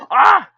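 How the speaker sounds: sharp startled scream; sudden scream. Real, not acted. Streamer screaming when a character grabs her wrist. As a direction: yelp as your wrist is grabbed.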